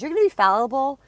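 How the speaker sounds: high, loud mocking voice; exaggerated mocking God voice; mock judgmental God. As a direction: ham up a scolding deity. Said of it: "God ... says".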